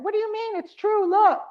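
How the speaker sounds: rising insistence, from protest to near-shout; defiant mother. Retold or imitated. Mother defending insult, growing louder.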